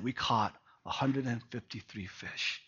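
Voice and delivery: ashamed murmur. Retold or imitated. Peter returning to Jesus, quietly ashamed. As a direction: mumble it like a man who can't face anyone.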